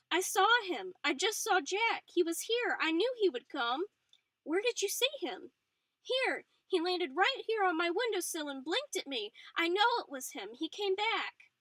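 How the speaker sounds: high, loud, eager telling; bursting with the news; breathless excitement. A narrator's reading. Patsy at the window telling Frances about Jack, high excited rush. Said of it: "her eyes wide with excitement".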